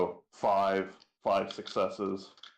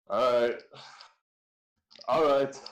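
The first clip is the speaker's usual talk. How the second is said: a touch of uneasy, louder resignation; shaken acceptance; 5 semitones higher, 4 dB louder than usual. Acted. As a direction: accept it through disgust. Player reacts, shaken.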